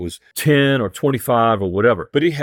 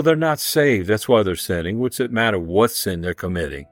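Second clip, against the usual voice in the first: normal talking voice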